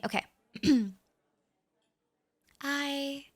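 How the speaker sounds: normal talking voice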